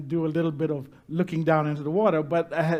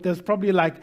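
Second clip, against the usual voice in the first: ordinary talk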